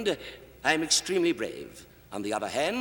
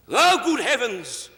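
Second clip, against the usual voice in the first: sudden loud, higher, slow startled cry; startled outburst; 7 semitones higher, 7 dB louder, slower than usual. Acted. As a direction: blurt it out as if suddenly shocked. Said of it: "sorry about that outburst".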